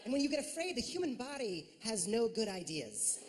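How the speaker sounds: normal talking voice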